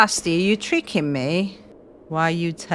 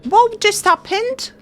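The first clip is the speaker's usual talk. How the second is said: shocked outrage. Real, not acted. Shocked by game event.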